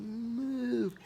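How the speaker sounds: high yelled move; shouted warning. Retold or imitated. Boy shouting at the hiker to move, high yell. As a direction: yell it to get him out of the way. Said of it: "I'm going".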